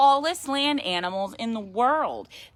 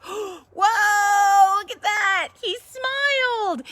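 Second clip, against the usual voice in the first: gasping, higher and louder, then a slow drawn-out "He smiled!"; amazed gasp; 10 semitones higher, 6 dB louder, much slower than usual. A narrator's reading. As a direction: gasp in wonder at the picture. Reader's amazed exclamation.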